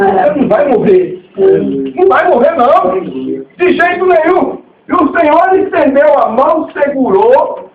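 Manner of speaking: high, rising declaration of faith; preaching at full force. Real, not acted. Preacher assuring a listener they will not die, impassioned preaching. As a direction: proclaim it with drawn-out, emphatic words.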